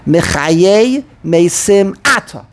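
slow phrase, "Atah" stressed AH-tah; stop at the comma. Shown as a demonstration. Teacher explaining the comma, front-stressed "Atah". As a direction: punch the first syllable of "Atah", then stop. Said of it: "that's why they put the comma here".

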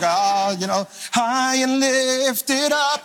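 the dog lost in worship, drawn out; dog's blissful worship voice. Pretend. Pastor voicing his dog Zion worshiping, high dreamy drawl. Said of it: "Zion is worshiping".

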